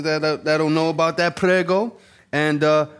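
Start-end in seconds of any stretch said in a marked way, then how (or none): 1.4-2.0 put-on Italian accent. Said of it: "that's a little Italian lingo".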